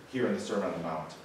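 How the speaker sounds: normal talking voice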